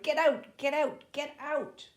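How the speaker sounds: high, very loud repeated yell; furious shout. Acted. Wireless officer throws the children out, yelling furiously. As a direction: bellow them out of the room. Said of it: "only response was to shout".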